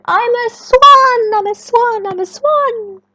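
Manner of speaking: high, loud joyful cries; excited shouting. Acted. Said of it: "he shouted".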